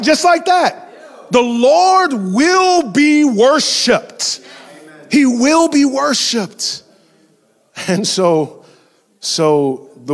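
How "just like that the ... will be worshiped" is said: slow, high, loud proclamation; booming declaration; preaching shout. Real, not acted. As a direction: thunder it out to the church. Preacher declares at full force.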